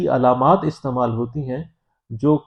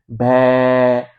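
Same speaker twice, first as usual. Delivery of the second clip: louder, open-mouthed bleat भै; sheep bleat imitation; 6 dB louder than usual. Shown as a demonstration. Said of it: "sheep sound कहते हैं". (भेड़ जैसी भै की आवाज़)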